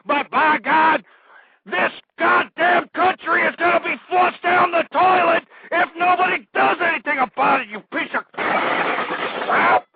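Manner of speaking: high, loud tirade breaking into a raw scream; explode, straining on the insults; enraged screaming. Real, not acted. Host cursing listeners who do nothing, screaming rage. Rant boils over into screaming.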